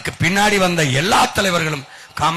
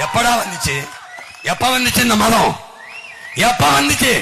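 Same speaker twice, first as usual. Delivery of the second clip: furious shout; 6 semitones higher than usual. Real, not acted. Orator demanding of the crowd when this religion came, shouting. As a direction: hurl the question at the crowd.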